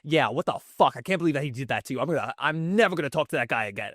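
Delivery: mock indignant Staten Island Italian rant; Staten Island Italian accent. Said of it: "all of a sudden he's just so".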